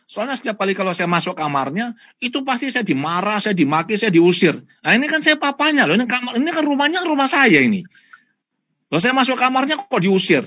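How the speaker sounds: high, loud, aggrieved complaint of a hurt father; frustrated father. Retold or imitated. Father complaining to the counsellor, high and aggrieved. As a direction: plead like a father thrown out of his own son's room. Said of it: "Dia bilang".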